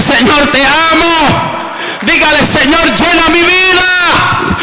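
high shouted prayer, slowing on key words; preaching at full force. Acted. Pastor leading the church in a shouted prayer. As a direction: lift the crowd into praise.

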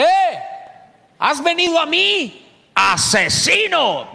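hammed-up high, loud cry at an enemy; dramatized shout. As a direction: play the defiant queen shouting down, stretching the accusation. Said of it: "Se la voy a dramatizar". (grito teatral, agudo y desafiante)

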